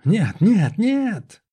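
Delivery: repeated cry climbing very high; desperate cries. A narrator's reading. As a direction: refuse it in rising panic. Hero sees the portal closing. (крик отчаяния, всё выше)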